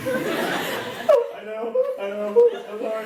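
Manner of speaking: high-pitched mock sobbing apology; hammed-up crying, voice way up high; blubbering mess. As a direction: blubber it out in an exaggerated squeak. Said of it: "I was just a blubbering mess".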